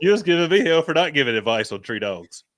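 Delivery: amused teasing. Real, not acted. Friend ribs the other over dog advice, amused teasing. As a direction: rib him, loud and amused.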